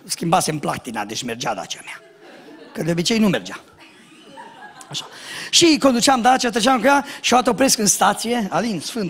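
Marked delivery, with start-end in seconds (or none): none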